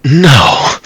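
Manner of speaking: sharp exclamation. Acted. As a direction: cut them off firmly. Sharp denial in the car.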